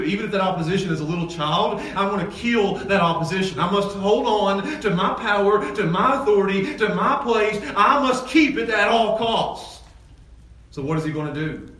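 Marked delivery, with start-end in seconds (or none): 0.0-9.8 Herod's resolve. Acted. Pastor voicing Herod to the church, lightly menacing. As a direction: lightly voice the king clinging to power.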